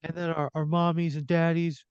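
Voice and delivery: put-on high-pitched worried kid voice; tearful child. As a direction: plead like a little boy telling on someone. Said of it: "he's kinda sobbing again".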